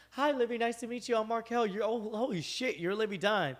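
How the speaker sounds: high, excited fan greeting; starstruck gushing. Retold or imitated. Meeting a famous gymnast, starstruck and high. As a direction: gush at her like a starstruck fan. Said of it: "and I was like".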